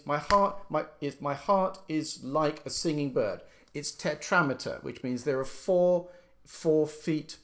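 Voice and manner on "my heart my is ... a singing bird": teacher showing the rhythm, My heart first; stressed metre demo. Shown as a demonstration. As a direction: beat out each foot of the line. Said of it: "so that means each foot is".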